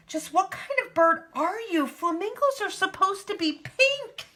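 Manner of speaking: very high-pitched incredulous flamingo; indignant. A narrator's reading. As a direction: question her in a high squawky scold. Flock challenges the odd flamingo.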